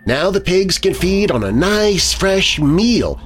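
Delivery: high, sinister taunt; menacing woman, pitched high; sinister hiss. A narrator's reading. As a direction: taunt with a sneer, slowing toward the end. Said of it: "she hissed at me".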